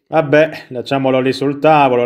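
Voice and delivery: usual speaking voice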